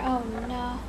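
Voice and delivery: normal talking voice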